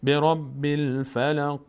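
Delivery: برب الفلق slow, qaf of الفلق lightly bounced; qalqala on the qaf. Shown as a demonstration. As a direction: recite برب الفلق drawing it out, a light echo on the final qaf. (قلقلهٔ خفیف روی قاف الفلق)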